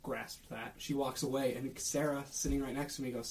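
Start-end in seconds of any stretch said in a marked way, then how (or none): none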